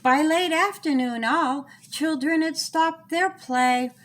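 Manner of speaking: plain reading voice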